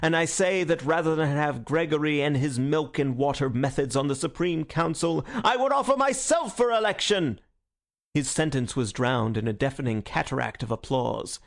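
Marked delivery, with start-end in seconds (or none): 0.0-7.4 high, ringing speech to a crowd; fiery orator. A narrator's reading. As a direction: declaim it, rising to a defiant climax. Syme's fierce election speech.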